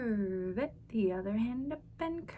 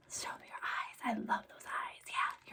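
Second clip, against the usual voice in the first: whisper; 6 dB quieter than usual. Acted. Hushed aside to kids.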